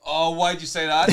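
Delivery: loud, high exclamation; excited protest. Real, not acted. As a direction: cry out in disbelief. Reacting as a goal goes in.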